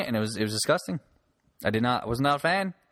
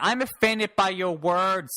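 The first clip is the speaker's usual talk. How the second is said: high, slow whine of offence; mock offended person; 7 semitones higher, slower than usual. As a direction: ham up wounded indignation, stretching it out. Mimicking an easily offended person.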